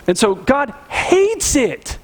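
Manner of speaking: slow, weighty stress on hates; slow emphasis. Real, not acted. Pastor telling the congregation God's view, slow and emphatic. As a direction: lean on every word.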